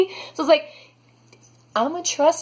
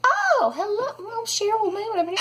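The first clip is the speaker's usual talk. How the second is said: put-on chirpy old ladies; 10 semitones higher than usual. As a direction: play a sweet dithering old lady. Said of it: "these ladies are like".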